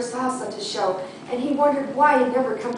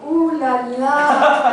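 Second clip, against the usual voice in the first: mock French ooh la la; 2 semitones higher, 8 dB louder, much slower than usual.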